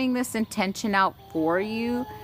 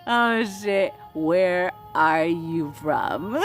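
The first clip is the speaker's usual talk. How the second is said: laughing; 4 semitones higher, much slower than usual. Real, not acted. Cracking up mid-question.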